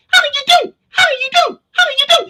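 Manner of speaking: squeaky, very high chant of a greeting; high-pitched munchkin chatter; tiny high munchkin voices. Retold or imitated. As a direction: pipe it up like a little munchkin. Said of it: "they were talking like that".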